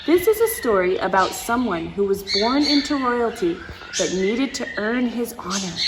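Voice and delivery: plain reading voice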